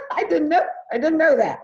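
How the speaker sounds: high, loud, laughing through the words; laughing admission. Retold or imitated. Teacher retelling being told she is staff, laughing admission. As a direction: sheepishly laugh off the mistake, pitch up. Laughing at own retold mistake.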